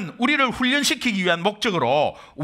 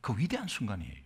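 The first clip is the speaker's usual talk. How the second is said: softer, lowered sermon voice; hushed preaching; 4 semitones lower, 8 dB quieter than usual. Acted. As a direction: draw them in quietly. (조용히 낮춰 전하는 설교 목소리)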